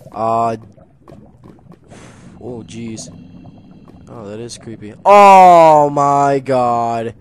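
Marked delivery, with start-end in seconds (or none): 5.0-7.1 loud, drawn-out cry of disbelief, falling in pitch; stunned shout. Real, not acted. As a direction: blurt it out in real shock. Sudden shock at a find.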